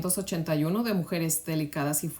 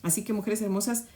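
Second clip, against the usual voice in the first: ordinary talk, nothing marked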